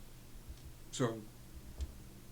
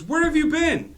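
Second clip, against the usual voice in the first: put-on high, loud scolding child; a little girl yelling at her lost friend; high shouting girl; 10 dB louder than usual. Pirate girl scolds Timo, high, loud shout.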